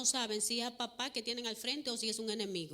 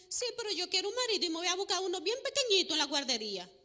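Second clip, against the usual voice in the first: put-on little girl; 7 semitones higher, 4 dB louder, faster than usual. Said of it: "Y le dice".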